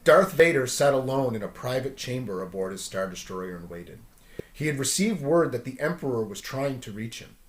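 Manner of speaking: ordinary delivery, nothing marked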